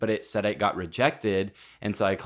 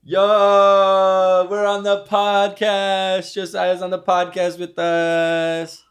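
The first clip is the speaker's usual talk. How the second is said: loud, slow, high announcement; calling out; 10 semitones higher, 10 dB louder, much slower than usual. Real, not acted. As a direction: shout it out to the room. Calling out to a guest.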